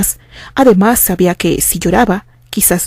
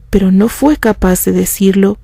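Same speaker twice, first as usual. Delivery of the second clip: plain reading voice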